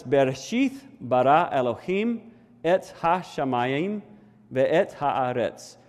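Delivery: careful "Bereshith bara Elohim", sounded out in Hebrew; Hebrew pronunciation attempt. Shown as a demonstration. Preacher sounds out the Hebrew first verse, careful Hebrew reading. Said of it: "I'll try to pronounce this".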